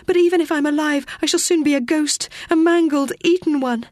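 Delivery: put-on girl's voice racing into dread; frightened young girl. Teo voiced, growing panic.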